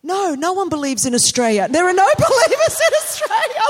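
flustered outburst rising to a high, loud squeal; re-enacted overexcited babble, climbing sharply; freaking out. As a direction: blurt it out in starstruck panic. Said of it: "I was freaking out so much".

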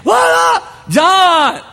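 high, loud mock greeting; calling out. Man greeting Crazy John across the crowd, loud and high. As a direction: shout a hello to a friend.

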